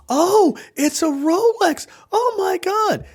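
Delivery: high, drawn-out mock gushing; hammed-up thrilled gift reaction; imitating delighted mom. Mom opens Rolex gift, retold.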